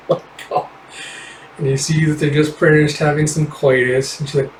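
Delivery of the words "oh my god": quick whispered laugh; amused whispered aside. Real, not acted. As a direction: giggle it under the breath. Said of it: "laughing aside".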